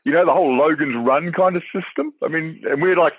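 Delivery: ordinary delivery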